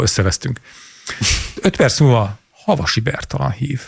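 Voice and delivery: usual speaking voice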